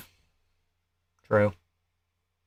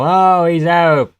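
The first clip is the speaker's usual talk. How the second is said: mock commentator call; 5 semitones higher, 6 dB louder, much slower than usual. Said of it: "commentary for the occasional".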